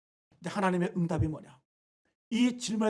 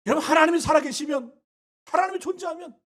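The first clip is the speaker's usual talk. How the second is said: high, loud cry; preaching shout; 6 semitones higher, 8 dB louder than usual. Real, not acted. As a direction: cry it out to the congregation. Preacher's impassioned climax.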